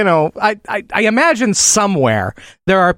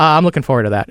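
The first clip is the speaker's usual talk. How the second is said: usual speaking voice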